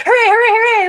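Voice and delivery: high, loud sarcastic hooray; mock cheer. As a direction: cheer like a fake-happy kid. Mocking the kid's cheer.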